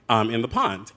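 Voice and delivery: ordinary talk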